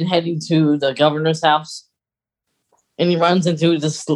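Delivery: ordinary talk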